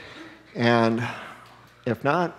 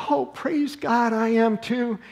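higher, slow, overjoyed imitation of the cashier; delighted cashier; 11 semitones higher, slower than usual. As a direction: gush it like you just found a friend. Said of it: "then she goes".

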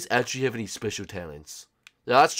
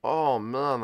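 usual speaking voice

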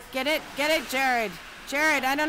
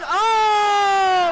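excited shout; 5 semitones higher, 8 dB louder than usual. Real, not acted. Cheering at a hockey game.

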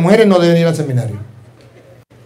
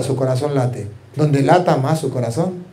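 soft hush; 5 semitones lower, 7 dB quieter than usual. Shown as a demonstration. Quiet aside about the heart.

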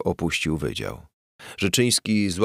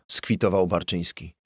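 ordinary delivery

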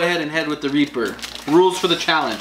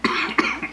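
coughing from spicy chip; 4 dB quieter than usual. Real, not acted. Coughing from the pepper.

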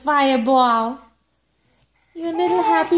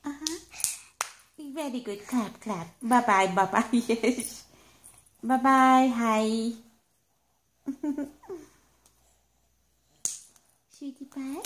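much softer and lower, hushed; soft bedtime voice; 4 semitones lower, 8 dB quieter than usual. Real, not acted. Settling baby to sleep.

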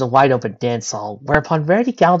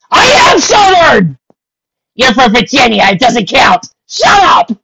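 high, loud indignant shout; angry shouting; 8 semitones higher, 8 dB louder than usual. Real, not acted. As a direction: shout back, offended. Real argument over accent.

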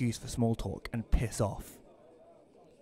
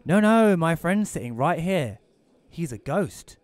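loud joking reply; 6 semitones higher, 7 dB louder than usual. Acted. Narrator's own quoted reply, loud joke.

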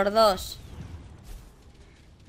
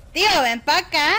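calling out; 5 semitones higher, 6 dB louder than usual. Real, not acted. Calling teammate over.

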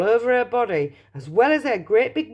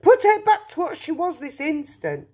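high-pitched, frantic demand; yelled demand; 10 semitones higher, 3 dB louder than usual. A narrator's reading. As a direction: demand it as a distraught mother. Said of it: "yelled Missus Beauregard".

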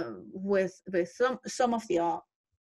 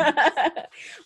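laugh; 5 semitones higher, 5 dB louder than usual. Real, not acted. Laughs about three hours.

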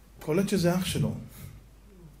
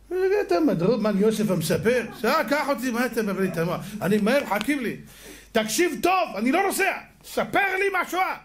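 put-on irritated old man; 7 semitones higher than usual. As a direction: play the old passenger losing his patience, pitch climbing. Old survivor snaps at driver.

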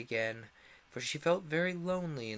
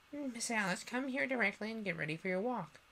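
much higher bossy nurse voice; imagined nurse; 9 semitones higher than usual. Retold or imitated. Imagined nurse calling Alice, higher and bossy. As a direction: summon her primly. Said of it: "fancying the sort of thing".

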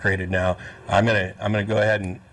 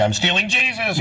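higher pitched mock yell; mock scream; 10 semitones higher than usual. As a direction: yell it out, hammed up. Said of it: "don't go screaming".

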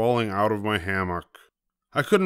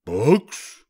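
puzzled, rising question; drawn-up quizzical pirate word; confused pirate; 3 dB louder than usual. A narrator's reading. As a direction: echo it, baffled. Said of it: "Braidbeard looked confused".

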